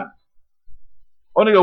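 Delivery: ordinary talk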